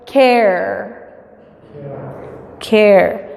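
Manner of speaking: care said as "ker", no trill; the right way: care with a soft English r. Shown as a demonstration. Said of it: "en inglés casi nunca ... la R fuerte".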